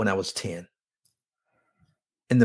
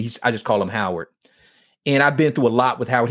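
ordinary talk

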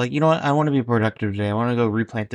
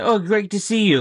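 gushing mock greeting; 7 semitones higher than usual.

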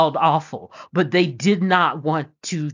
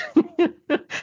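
high yelping dog laugh; dog's laugh; 12 semitones higher than usual. Pretend. The hound dog laughs at Bob, high and yelping. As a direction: let the dog cackle high and short.

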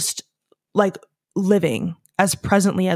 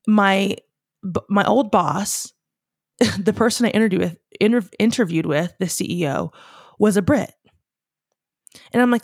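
ordinary talk, nothing marked

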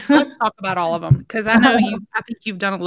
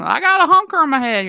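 Southern patient voice; 8 semitones higher, 5 dB louder than usual. Retold or imitated. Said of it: "somebody comes in and".

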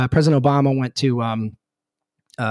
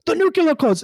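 high, far-off Schwarzenegger-style accent impression; Arnold accent. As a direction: lean into an Austrian Arnold accent. Governor clarifies to the aide.

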